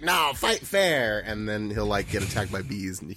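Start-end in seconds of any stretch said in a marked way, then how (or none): 0.0-1.2 slow, raised mock yell; mock scream. Imitating Ocelot screaming at the player, mock scream. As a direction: drag it out as an imitated outraged cry. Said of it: "he, like, screams and he's like".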